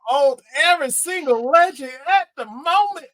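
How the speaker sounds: put-on pimp, pitched well up; pimping hype-man voice. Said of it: "let me do my pimping voice".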